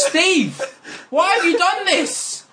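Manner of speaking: very high, anguished mock wail; distraught dog owner. Joke teller as owner catching his talking dog, high mock-anguished cry. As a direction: wail it in hammed-up disbelief.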